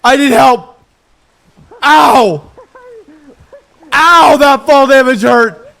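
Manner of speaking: pained yelling for help; man down shout. Acted. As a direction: cry out hurt and loud. Hurt character calling for help.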